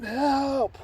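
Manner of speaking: high, drawn-out mock cry for help; retold scream. Drowning child calling out for help, mild mock scream. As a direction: imitate screaming for help without full volume. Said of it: "I'm screaming".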